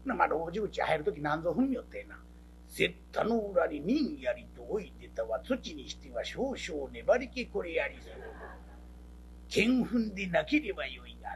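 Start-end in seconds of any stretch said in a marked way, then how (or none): 2.8-11.3 lightly voiced formal storyteller, lower; a hint of a stiff storyteller musing; pompous storyteller. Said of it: "講釈師". (少し低めの講釈師の口まね)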